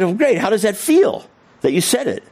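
high-pitched enthusiastic question; retold encouragement. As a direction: cheer him on, pitched well up. Retold encouraging reply to teen.

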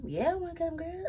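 high, excited mock reply; giddy girlish yes; eager yes. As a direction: jump at the invite, voice up high. Excited reply to mom's invite.

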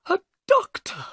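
very high-pitched excited exclamation; excited cry. A narrator's reading. Nervous little man learns a guest is a doctor, excited. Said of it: "cried Thaddeus Sholto excitedly".

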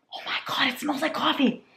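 hushed, high, breathless amazement; awed whisper. Real, not acted. Smelling the coffee candle, awed whisper. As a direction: whisper it softly in wonder.

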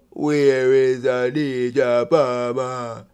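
very slow, dragged-out mock question; mocking slow talk. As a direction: drawl it out as if everything is in slow motion. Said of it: "Everything I slowed".

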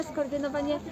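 usual speaking voice

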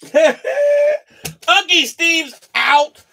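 slow laughing shout over the card; delighted laughing yell. Real, not acted. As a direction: crow it out through laughter.

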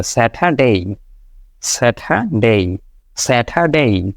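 slow স্যাটারডে, no r, ending ডেই; right Saturday. Shown as a demonstration. Teacher repeats the correct form slowly. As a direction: stretch স্যাটারডে, no r. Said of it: "এভাবে উচ্চারণটা হবে".

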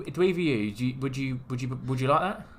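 ordinary delivery

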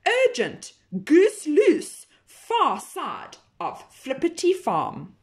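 high, urgent put-on caller; disguised voice. Pig on the payphone faking an emergency. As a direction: squeak out an urgent alarm in disguise. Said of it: "disguising his voice".

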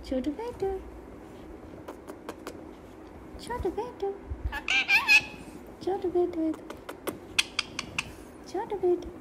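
high cooing call to a pet; high-pitched pet-calling coo; high sing-song baby talk. Acted. Coaxing pet parrot to talk.

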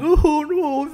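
high-pitched imitated wail; hammed-up high cry; mock crying.

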